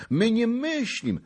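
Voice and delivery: high-pitched glad assurance; joyful cry. A narrator's reading. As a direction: eagerly reassure the lord. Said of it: "zawołał z radością dziad". (radosne zapewnienie)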